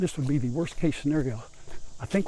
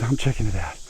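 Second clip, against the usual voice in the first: a lower put-on dog voice; voicing the dog; 5 semitones lower than usual. Owner voices his dog exploring the new pen, in a lower put-on voice. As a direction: speak for the curious dog in a lower, different voice. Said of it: "he says".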